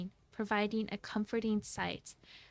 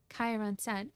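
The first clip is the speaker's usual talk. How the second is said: normal talking voice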